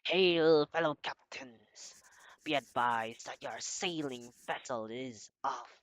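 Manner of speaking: loud pirate captain reading a letter. Acted. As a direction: boom it out like an old sea captain. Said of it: "I'm just gonna do my best, uh, pirate voice".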